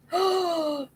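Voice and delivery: sharp intake of breath; gasp. Real, not acted. Reacting to what was heard before saying no, gasp. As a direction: gasp in, drawn out.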